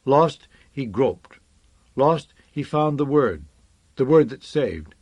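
plain reading voice

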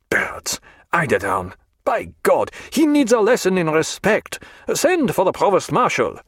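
indignant outburst climbing high; angry cry. A narrator's reading. Colonel outraged by talk of birds, crying out. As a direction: start in scornful disbelief, rise to outrage. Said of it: "cried the colonel".

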